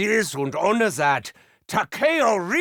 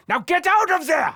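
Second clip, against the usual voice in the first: 1 commanding shout; 8 semitones higher than usual. Acted. Ordering comrade to retreat.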